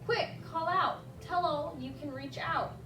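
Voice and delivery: ordinary delivery, nothing marked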